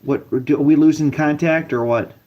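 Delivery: ordinary delivery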